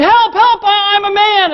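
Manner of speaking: high-pitched, hammed-up cry for help; mock scream. Joke's gorilla-suited man to the lion, mock screaming. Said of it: "The guy screamed".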